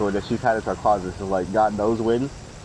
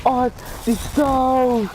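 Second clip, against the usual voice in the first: high, slow panicked call; high sister crying out about the stove; 14 semitones higher, much slower than usual. Retold or imitated. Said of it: "He was like".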